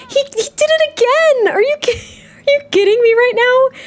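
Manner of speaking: high, exasperated half-laughing disbelief; can't believe he did it again, squeal it. Real, not acted. Player seeing her toddler misbehave again, high exasperated disbelief.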